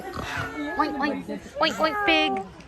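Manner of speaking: put-on slow, high oinking for the baby; pig oinks. Shown as a demonstration. Mom showing her child how a pig oinks. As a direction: draw out the oinks to teach a toddler. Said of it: "Come on, say".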